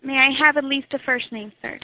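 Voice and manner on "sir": normal talking voice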